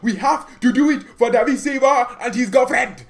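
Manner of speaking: put-on loud, high foreign-accented rant; hammed-up accented coach outburst; Pep. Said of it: "thanks, Pep, for that little message".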